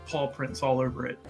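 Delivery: ordinary talk, nothing marked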